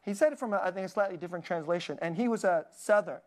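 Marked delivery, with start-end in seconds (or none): none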